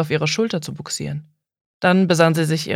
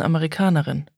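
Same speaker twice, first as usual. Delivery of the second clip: ordinary delivery, nothing marked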